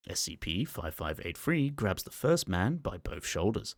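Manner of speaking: usual speaking voice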